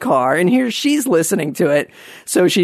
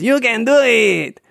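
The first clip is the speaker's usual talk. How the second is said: higher, slower Rob Schneider bit; Rob Schneider catchphrase; 6 semitones higher, slower than usual. Retold or imitated. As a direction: drag it out as the goofy sidekick. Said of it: "he says".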